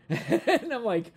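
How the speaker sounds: laughing through the words; can't stop laughing at the story. Real, not acted. Telling the story, laughing at the memory. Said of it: "I'm just dying".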